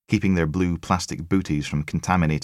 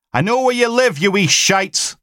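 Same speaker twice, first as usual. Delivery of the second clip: high, loud bellow; 7 dB louder than usual. Acted. Logan bellowing at the kids from his doorstep. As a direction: roar it at the kids. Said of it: "bellowed it out".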